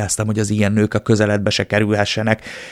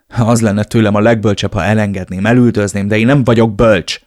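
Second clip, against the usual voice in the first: louder, building to a shout; raised voice; 5 dB louder than usual. Acted. The narrator argues with Elena, raising his voice louder. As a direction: raise your voice, building toward the end. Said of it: "emeltem fel a hangom".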